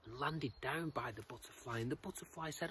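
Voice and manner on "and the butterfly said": ordinary delivery, nothing marked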